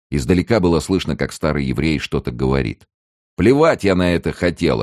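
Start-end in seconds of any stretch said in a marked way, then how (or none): 3.4-4.8 lightly voiced gruff dismissal, louder and higher; rough voice. A narrator's reading. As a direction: brush him off roughly. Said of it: "отвечал ему грубый голос".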